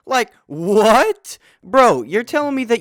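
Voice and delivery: loud, high disbelieving what; outraged double take at the screen; incredulous. Real, not acted. Reviewer reacting to Morbius forgetting the murder, loud disbelief.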